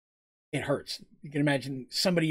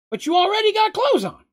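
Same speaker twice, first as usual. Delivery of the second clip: much higher, loud and puzzled; dim confused character; 8 dB louder than usual. Acted. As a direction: blurt it out like a simple-minded oaf. Moogs confused, voiced high.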